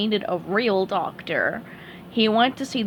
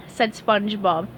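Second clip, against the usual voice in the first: usual speaking voice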